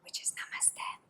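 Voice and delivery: secret whispered code word. Shown as a demonstration. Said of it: "say a secret yoga code word".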